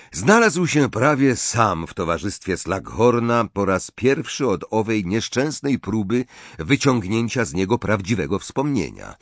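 usual speaking voice

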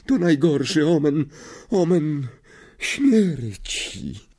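high, slow, doom-laden seer voice; ominous seer. A narrator's reading. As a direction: intone the omen with dread. Trelawney describes the Grim.

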